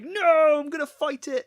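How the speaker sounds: high, very loud mock-heroic yell; defiant shout. Podcaster playing pilot refusing a retreat order, defiant shout. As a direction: bellow your refusal, hammed up.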